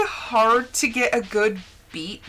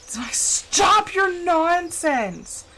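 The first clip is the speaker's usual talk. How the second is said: higher, very slow stern mock scolding; fed-up parent; 7 semitones higher, much slower than usual. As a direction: drag it out like a weary mom. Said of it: "I'm like".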